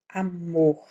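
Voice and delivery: "Amor" with the weight on -mor; stress on the last syllable. Shown as a demonstration. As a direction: lean on the final syllable of "Amor". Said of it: "a sílaba forte dela tá aqui no final".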